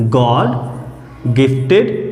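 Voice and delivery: slow, spelled-out "गॉड gifted"; slow answer. Shown as a demonstration. Teacher answering her own question, slow and clear. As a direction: say "गॉड gifted" slowly, word by word. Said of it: "तो क्या कहा जाता है?".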